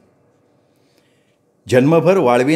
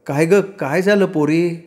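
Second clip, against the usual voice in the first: higher, slow, gently worried asking; concerned mother-in-law; 5 semitones higher, slower than usual. A narrator's reading. Mother-in-law asks crying girl.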